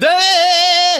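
loud, high, long "uh"; interrupting "uh". Retold or imitated. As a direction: blare a high "uh" over the talker. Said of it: "you gotta interrupt him with".

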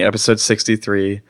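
usual speaking voice

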